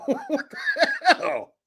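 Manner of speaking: very high, squeaky laughing words; slow, high, helpless laughter; real laughing fit. Real, not acted. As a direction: barely get it out through laughter. Cracking up at own bit.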